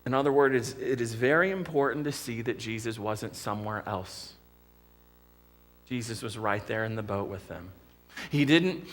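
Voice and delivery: ordinary talk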